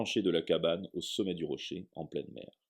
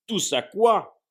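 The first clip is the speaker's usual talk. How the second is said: much higher, loud thundering echo; 13 semitones higher, 11 dB louder, much slower than usual. Acted. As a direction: roar the question back at the boy. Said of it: "tonna Hagrid".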